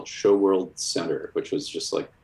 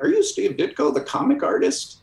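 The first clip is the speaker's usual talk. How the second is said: put-on nervous young fan; 14 semitones higher, 3 dB louder than usual. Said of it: "I just went like".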